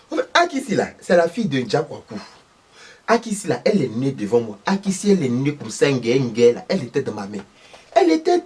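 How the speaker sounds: voix aiguë de vieille dame, animée; vieille maman ivoirienne. Acted. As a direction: raconte comme une belle-mère du village. Thérèse parle d'Akissi. (Madame Thérèse, voix aiguë de vieille maman)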